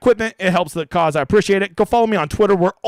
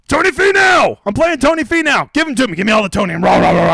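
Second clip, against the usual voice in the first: loud, much higher excited shout; hyped; 5 semitones higher, 5 dB louder than usual. Real, not acted. Announcing his favorite pick, a loud higher hyped shout. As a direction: cheer your guy like a fan.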